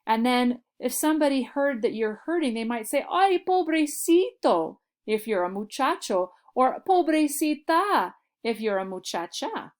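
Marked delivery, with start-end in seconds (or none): 3.1-4.8 much higher, slow, cooing sympathy; pitying aww. Acted. Someone who heard you're hurting, higher cooing sympathy. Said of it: "kinda like saying, "Oh, poor you". 6.9-8.1 much higher, slow, cooing sympathy; pitying aww. Acted. Someone who heard you're hurting, higher cooing sympathy. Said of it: "kinda like saying, "Oh, poor you".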